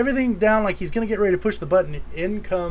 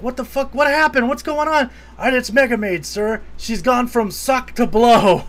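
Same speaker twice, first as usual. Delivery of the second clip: high, loud mock panic; alarmed crew; 6 semitones higher, 4 dB louder than usual. Alarmed crew voiced in film retelling.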